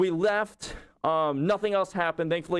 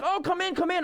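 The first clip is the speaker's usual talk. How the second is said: much higher, bright inviting call; eager welcome; 13 semitones higher than usual. Retold or imitated. Business owners calling the fox in, much higher and eager. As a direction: coax him inside cheerfully.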